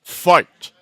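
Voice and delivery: loud, booming one-word command; announcer call. Acted. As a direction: call the fight like the game voice. Announcing round start.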